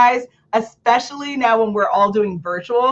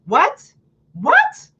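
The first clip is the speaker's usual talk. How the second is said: what, jumping way up in pitch; high baffled what; 11 semitones higher than usual. Real, not acted. Baffled at a speaker full of ums, high and incredulous. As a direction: act baffled, voice shooting up. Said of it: "reacting to the ums".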